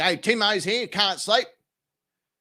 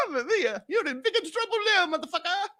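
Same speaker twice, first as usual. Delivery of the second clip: hammed-up Italian accent, pitched higher; mock Italian accent; 12 semitones higher than usual. Streamer imagines the Italian embassy scolding him, mock Italian accent. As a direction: play an Italian official threatening him in a jokey Italian accent.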